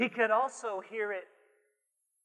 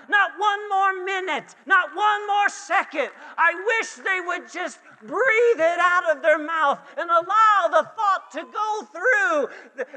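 high, loud, building declaration; impassioned cry; 7 semitones higher, 6 dB louder than usual. Acted. Preacher voicing people pleading to be set free, impassioned shout. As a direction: hammer each refusal, climbing to a held cry.

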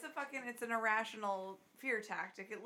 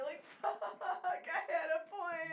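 laughing; 9 semitones higher, slower than usual. Real, not acted. Laughing at own lost point.

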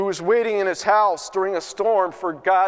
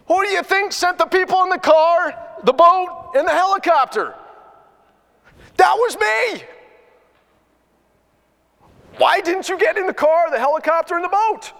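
much higher, louder playful God voice; amused God; 10 semitones higher, 4 dB louder than usual. Retold or imitated. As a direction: gently tease the man for missing the signs. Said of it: "God ... laughing, saying".

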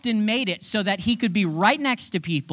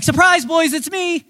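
playful reveal; 8 semitones higher, 6 dB louder than usual. Retold or imitated. Jesus reveals himself.

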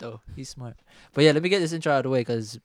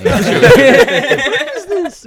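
laughing; 13 dB louder, much slower than usual. Real, not acted. Laughing out the question.

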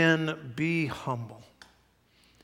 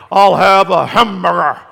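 hammed-up macho bellow, very loud; mock manly voice; 4 semitones higher, 16 dB louder than usual. Preacher ordering a hamburger from waiter, exaggerated manly bellow. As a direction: boom it out to show how a man talks. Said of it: "talk like a man".

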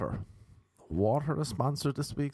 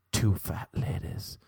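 whispering; 7 semitones lower than usual. Pretend. Whispered bingo call.

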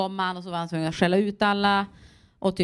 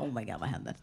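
stunned aside; 9 semitones lower, 10 dB quieter, faster than usual. Retold or imitated. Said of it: "Jag bara".

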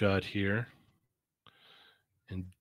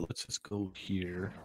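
whispering; 9 dB quieter, slower than usual. Real, not acted. Quiet aside while browsing.

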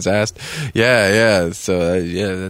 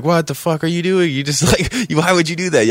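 pitched-up, exasperated mock yell; incredulous outburst; 8 semitones higher than usual. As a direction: voice climbing in disbelief at a reckless friend. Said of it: "Kevin's like".

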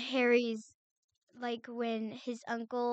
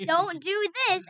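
high, mock-villain warning; hammed-up caution; Voldemort; 6 semitones higher, 4 dB louder than usual. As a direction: warn like a silly villain.

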